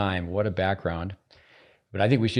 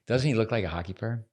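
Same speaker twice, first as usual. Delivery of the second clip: usual speaking voice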